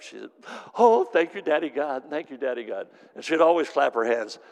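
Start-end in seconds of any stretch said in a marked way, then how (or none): 0.8-2.9 high, loud burst of praise settling down; delighted thanks. Retold or imitated. As Marge thanking Daddy God for love, delighted. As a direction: imitate an overjoyed, grateful worshipper.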